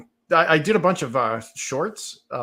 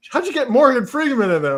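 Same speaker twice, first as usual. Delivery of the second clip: louder, higher amazed question; surprised listener; 10 semitones higher, 8 dB louder than usual. Retold or imitated. Friend amazed at his voice, louder and higher. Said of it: "and it was like".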